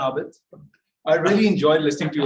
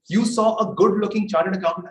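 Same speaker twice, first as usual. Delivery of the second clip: ordinary talk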